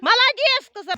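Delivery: very high, loud cheering. Real, not acted. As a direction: yell encouragement at a racer. Mother cheering daughter in ski race.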